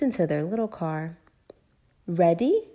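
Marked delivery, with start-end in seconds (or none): 2.1-2.7 a touch louder, cheery question; rally the family brightly; Daddy Pig, cheerily. A narrator's reading. Daddy Pig to the family in the car, cheery. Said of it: "asked Daddy Pig cheerily".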